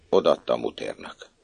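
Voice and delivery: plain reading voice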